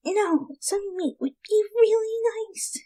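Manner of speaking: soft, breathy whisper of craving; hungry whisper. Acted. Rainbow confesses to friends she craves pony meat. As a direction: whisper it like a guilty secret.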